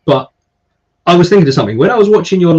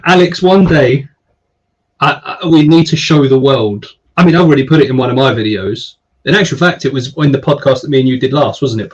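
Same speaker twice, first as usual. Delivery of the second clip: normal talking voice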